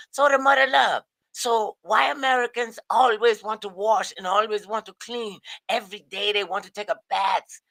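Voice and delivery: put-on lightly done African accent. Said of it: "maybe that was my African accent".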